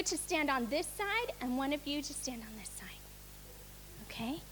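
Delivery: unvoiced whispered instructions. Real, not acted. Teacher quietly placing the two angels, whispering. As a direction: whisper the directions so only the angels hear.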